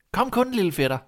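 high-pitched beckoning call. A narrator's reading. Boy beckoning his dead cousin, calling out. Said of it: "gav sig til at vinke og råbte".

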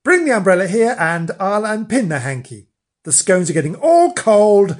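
loud, high exasperated order; annoyed. A narrator's reading. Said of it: "getting annoyed".

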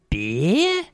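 puzzled "beer?" sliding upward; Australian barman. Acted. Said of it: "He's like".

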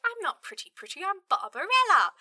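high mock Barbarella; lightly voiced high Barbarella. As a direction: pipe up high and indignant. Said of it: "Barbarella's like".

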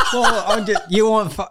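high, loud burst of laughter; real laughing outburst before honest critique. Real, not acted. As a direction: crack up and shout it out.

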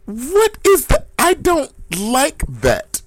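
high, slow, grossed-out squeal; disgusted squeal. Real, not acted. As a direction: recoil in disgust, voice way up. Disgusted reaction to story.

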